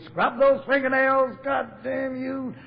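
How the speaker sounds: put-on slow, high furious shout at a little boy; furious father. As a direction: bellow it like an enraged drunk father over a child. Father's rage retold by son.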